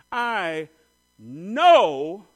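emphatic, certain declaration with a pause between words; firm conviction. Retold or imitated. Woman sure of her healing, firm conviction. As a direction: declare it slowly, swelling loud on "know". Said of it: "That is faith and expectation".

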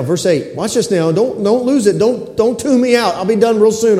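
ordinary talk, nothing marked

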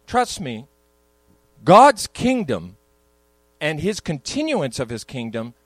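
ordinary delivery

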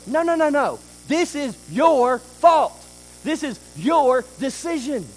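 God firmly refusing the excuse. Retold or imitated. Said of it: "God is going".

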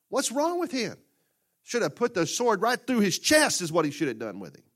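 high, loud incredulous scoff; scoffing crowd. Acted. Preacher voicing gossiping townsfolk, loud incredulous scoff. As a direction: sneer at his mercy.

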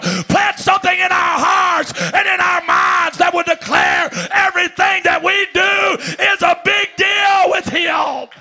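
high, loud preaching cry; shouted prayer. Retold or imitated. Preacher retelling his prayer to God, shouted high and loud. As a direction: cry it out to God at full force.

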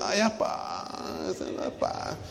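put-on slow, drawn-out sigh of a reluctant boy; mock childish whine. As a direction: lightly imitate a kid who doesn't want to go. Whining as a boy to dad. (queja infantil fingida, lenta y suspirada)

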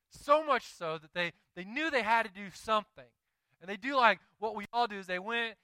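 fiery sermon push; preaching. Acted. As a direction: preach it with building force. Preaching rising.

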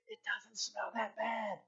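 very quiet, slow confession; private inner voice. Retold or imitated. Husband in his heart dodging litter, hushed. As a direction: voice the secret thought in a hushed tone. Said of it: "But in my heart, I was really like".

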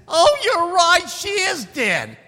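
high, slow mock little-girl wail; hammed-up squeaky girlish voice; little girl's voice. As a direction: give in like a whiny little girl. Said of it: "You like that little girl's voice?".

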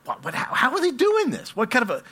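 can't believe your eyes; astonished disbelief. Retold or imitated. Watching traffic merge from the van, astonished.

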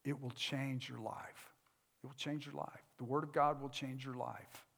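soft, low preaching; hushed promise. Real, not acted. Preacher promising listeners that scripture changes lives, hushed. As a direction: drop the voice right down for a promise.

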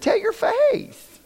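high, slow mock quip; drawn-out teasing retort to the wife; a playful jab, stretched high and slow; mock pointed. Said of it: "I said".

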